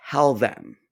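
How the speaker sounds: normal talking voice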